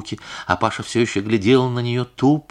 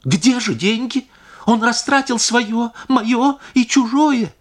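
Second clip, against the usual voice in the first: high, slow, rising to a cry; anguished demand; 4 dB louder, slower than usual. A narrator's reading. Wife demanding where the stolen money went, anguished.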